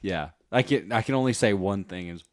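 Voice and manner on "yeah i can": ordinary talk, nothing marked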